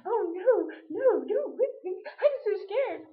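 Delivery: high, loud hammed-up pleading, playfully terrified; mock scared. Dave pictured begging not to be whipped, mock frightened. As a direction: beg like a cartoon coward, in jest. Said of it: "I could just picture him going".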